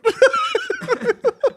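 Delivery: high, wheezing laugh; shrieking laugh. Real, not acted. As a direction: lose it completely.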